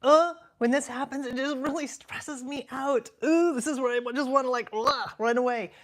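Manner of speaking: stressed-out whine. Retold or imitated. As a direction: replay her overwhelmed self. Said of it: "I was saying things like".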